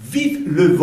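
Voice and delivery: "Vive le vent" spaced out for learners; pronunciation demo. Shown as a demonstration. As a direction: model "Vive le vent" with separated, careful French sounds. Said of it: "vamos a ver cómo se pronuncia".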